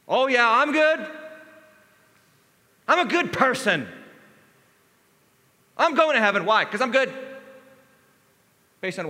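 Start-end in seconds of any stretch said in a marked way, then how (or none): 0.1-7.1 raised, louder smug voice, slowed; proud and pleased with yourself; the self-righteous one, boasting aloud; smug self-assured. Retold or imitated. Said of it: "trusting his own goodness".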